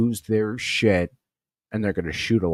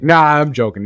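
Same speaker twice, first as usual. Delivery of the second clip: loud, higher, teasing release; playful laugh-off; 5 semitones higher, 8 dB louder than usual. Retold or imitated. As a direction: break into a grin. Sergeant dropping the act.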